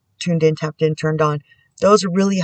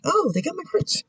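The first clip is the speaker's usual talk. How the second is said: mock amazement; 14 semitones higher, 8 dB quieter than usual. Imitating herself spotting her cards on another channel, high mock surprise. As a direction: squeak it out like you're caught off guard. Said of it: "Then I'm like".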